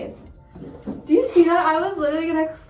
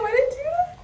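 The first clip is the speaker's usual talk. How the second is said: high squeal of regret; panicked high wail; 11 semitones higher, faster than usual. Real, not acted. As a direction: pitch way up in dismay. Panic after big cut.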